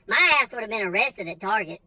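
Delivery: ordinary delivery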